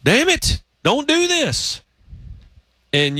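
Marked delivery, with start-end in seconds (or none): 0.0-1.8 high-pitched re-enacted yell at the TV; imitated yell of alarm, pitched way up; retold yell. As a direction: cry out high, without real volume. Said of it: "I literally yelled".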